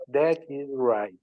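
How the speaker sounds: slow and separate, each word apart. Shown as a demonstration. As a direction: spell it out slowly. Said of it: "fala, fala devagar".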